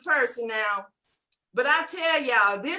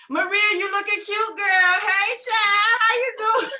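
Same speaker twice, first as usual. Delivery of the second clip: put-on high, loud hey child; gushing greeting; 5 semitones higher, 5 dB louder than usual. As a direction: gush like greeting a friend. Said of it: "a message from me".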